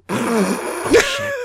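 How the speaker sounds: sudden high startled "oh shit"; startled exclamation. Retold or imitated. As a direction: jump up in alarm. Startled reaction, huge pitch jump.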